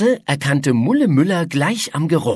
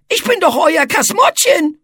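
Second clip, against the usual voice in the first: high, loud offended little monster; indignant monster; 12 semitones higher, 4 dB louder than usual. A narrator's reading. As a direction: protest that you are his friend. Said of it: "rief Etze empört". (empörtes kleines Monster)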